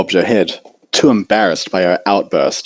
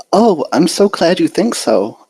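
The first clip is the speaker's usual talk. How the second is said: shy, hesitant little pony voice, lightly pitched up; timid Fluttershy; 10 semitones higher than usual. A narrator's reading. Fluttershy relieved.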